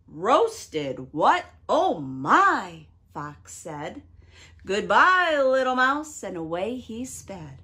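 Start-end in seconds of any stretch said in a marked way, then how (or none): 0.1-2.9 jolted by the news; alarmed fox. Acted. Fox startled. 4.7-6.2 loud hurried farewell; hasty goodbye. Acted. Fox flees.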